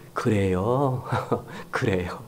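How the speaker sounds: just agree softly, no argument; gentle agreement. Shown as a demonstration. Pastor demoing the best reply, soft and accepting. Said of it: "가장 좋은 것은요".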